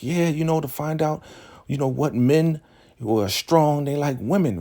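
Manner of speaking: high put-on wheedling voice; excuse-making. Acted excuse to the ruler.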